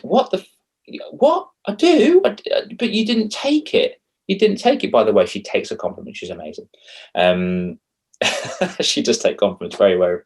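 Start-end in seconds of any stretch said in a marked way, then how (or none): 0.0-4.9 indignant disbelief. Retold or imitated. Replaying a row with his girlfriend, outraged and shrill. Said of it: "I'm like".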